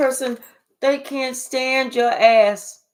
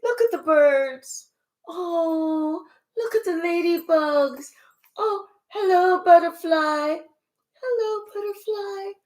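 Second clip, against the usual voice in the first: high, slow, dreamy mock joy; imitating someone blissed out and carefree; 4 semitones higher, slower than usual. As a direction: swoon over birds and butterflies in a sing-song. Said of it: "not being like".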